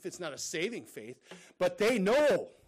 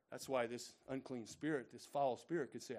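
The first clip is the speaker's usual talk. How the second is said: ordinary talk, nothing marked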